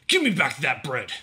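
loud, snarled demand; snarling. A narrator's reading. Dow prying at Ellen's arms, loud snarl. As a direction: demand it back in anger. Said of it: "he snarled".

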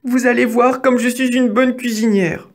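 high-pitched mouse voice; eager little mouse. A narrator's reading. Mouse boasting of her cooking to the wolf, high and eager.

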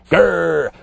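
growled. A narrator's reading. Fang telling Bungo no, growled. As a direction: growl like a dog refusing. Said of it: "growled Fang".

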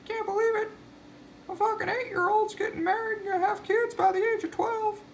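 squeaky high mock cheer, near crying; hammed-up falsetto glee; mock wedding guest. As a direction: parody the happy guests in a shrill falsetto. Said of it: "They're like".

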